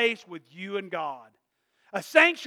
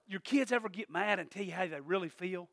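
ordinary delivery

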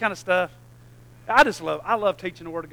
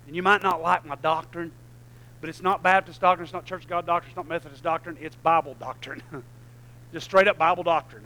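normal talking voice

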